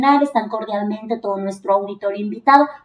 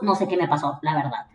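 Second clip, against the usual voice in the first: ordinary talk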